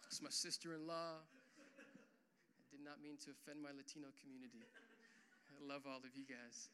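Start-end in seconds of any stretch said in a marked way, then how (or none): 1.2-6.7 quiet aside. Real, not acted. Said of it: "love you, Dan".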